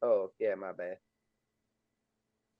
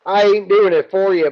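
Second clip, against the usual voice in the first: high, loud, emphatic rebuttal; loud protest; 8 semitones higher, 12 dB louder than usual. Real, not acted. Game master rebuts laughing player about monster movement, loud protest. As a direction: push back hard at the teasing.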